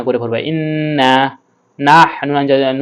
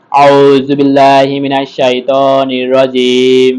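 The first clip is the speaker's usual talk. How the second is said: slow, chanted recitation; Quranic recitation; 5 dB louder, much slower than usual. Shown as a demonstration. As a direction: draw each word out in tajweed style. Teacher recites the opening formula.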